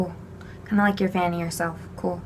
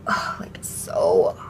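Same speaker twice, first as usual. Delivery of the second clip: mock overheated sigh; 13 semitones lower than usual. Acting out being hot.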